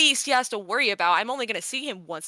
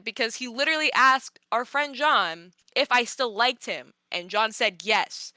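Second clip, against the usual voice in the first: ordinary delivery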